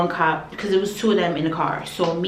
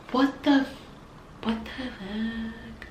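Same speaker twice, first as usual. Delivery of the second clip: stunned; 4 semitones higher, 7 dB quieter, slower than usual. Retold or imitated. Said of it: "I was really sitting there, like, shocked".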